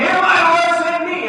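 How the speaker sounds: high, loud eager "here I am"; eager volunteer. Retold or imitated. Isaiah volunteering to God, eager and loud. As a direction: hand shot up, keen to go.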